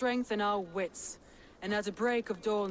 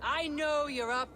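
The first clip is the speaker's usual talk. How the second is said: raised, high call up to the walls; 7 semitones higher, slower than usual. Acted. Leader calling up to the enemy lord on the walls, defiant shout. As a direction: shout a challenge up at him.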